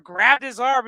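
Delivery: usual speaking voice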